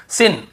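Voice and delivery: सिन with a short I, "sin"; wrong vowel. Shown as a demonstration. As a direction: misread sign as short सिन. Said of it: "ऐसे नहीं पढ़ना".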